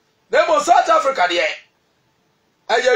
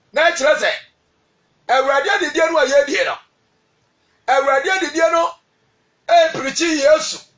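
normal talking voice

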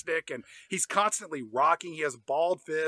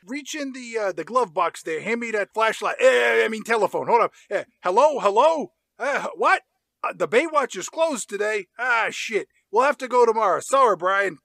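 Ben Affleck impression; 5 semitones higher, 5 dB louder than usual. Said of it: "Ben Affleck says".

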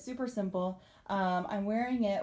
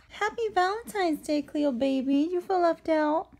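pet talk; 8 semitones higher, 5 dB louder than usual. Real, not acted. Talking to her cat Cleo.

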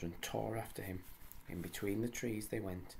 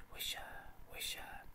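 soft breathy whisper of the leaves; rustling leaf whisper; 5 dB quieter than usual. A narrator's reading. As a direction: let the leaves whisper it, fading. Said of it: "leaves overhead saying".

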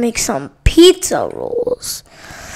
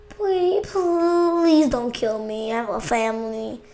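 mock begging; 2 semitones lower, 11 dB quieter than usual. Begging not to be killed.

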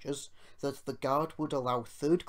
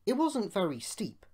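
ordinary delivery

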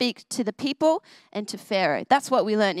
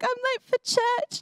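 much higher, slow panicked wail; mock panic; 12 semitones higher than usual. Girl telling mum she is late, high slow panic. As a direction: wail it like you overslept. Panicked reply in dream story.

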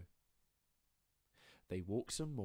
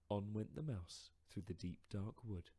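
plain reading voice

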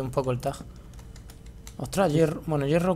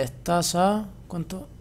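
normal talking voice

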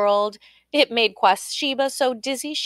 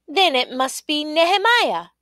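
high-pitched, declaimed guess; eager guess; 7 semitones higher, 3 dB louder than usual. A narrator's reading. Queen trying another name, high-pitched guess. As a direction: try the name, voice lifted high.

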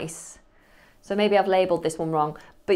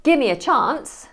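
"Give me a chance" louder and higher, give stressed; exasperated plea; 6 semitones higher, 5 dB louder than usual. Shown as a demonstration. Flustered person begging for a moment, loud. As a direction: plead with exasperation. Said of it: "Or you could say".

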